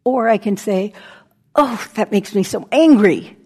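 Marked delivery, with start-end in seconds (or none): 1.5-3.3 louder mock outburst of anger; acted anger. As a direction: build to a punch on "angry". Acting out a burst of anger.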